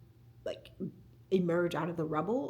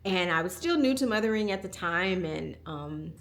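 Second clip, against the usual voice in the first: ordinary delivery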